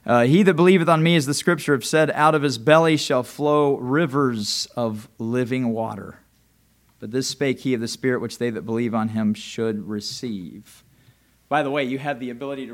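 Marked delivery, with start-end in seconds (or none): 0.2-10.8 scripture reading. Acted. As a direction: intone the verse. Reads scripture aloud.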